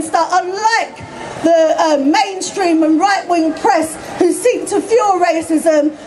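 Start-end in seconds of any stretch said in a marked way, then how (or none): none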